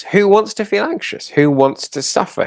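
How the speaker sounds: high-pitched rhetorical disbelief; incredulous protest. Acted. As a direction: ask it as if nobody ever would. Acted rhetorical protest for anxious clients.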